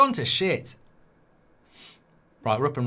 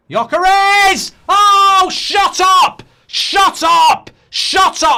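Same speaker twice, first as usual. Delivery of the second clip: ecstatic yell, much higher and louder; excited shout; 12 semitones higher, 12 dB louder, much slower than usual. Real, not acted. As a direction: scream the goal with joy. Celebrating the goal.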